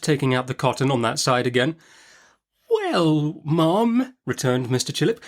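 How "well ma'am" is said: higher, meek little voice; a nervous doctor placating; timid doctor. A narrator's reading. Timid Mister Chillip answers the fierce aunt, meekly.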